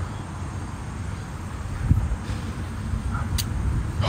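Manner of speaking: a long, unvoiced sigh of disgust; deep exasperated exhale; long shaky sigh. Real, not acted. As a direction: blow it all out, fed up. Stepped in poo, calming down.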